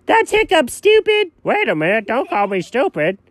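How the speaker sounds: ordinary talk, nothing marked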